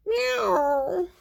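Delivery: long, high cat cry; imitated cat meow. A narrator's reading. Kitty being rinsed of shampoo, high protesting meow.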